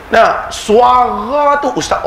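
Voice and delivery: put-on Kedah accent. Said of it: "Saya ajuk orang Kedah".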